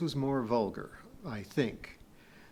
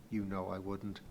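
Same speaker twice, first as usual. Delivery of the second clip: low, soft reassurance; reassuring; 5 semitones lower, 7 dB quieter than usual. A narrator's reading. Kip calming uneasy Pee-wee, reassuring.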